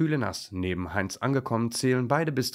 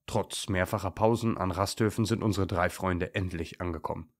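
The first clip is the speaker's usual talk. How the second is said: ordinary delivery, nothing marked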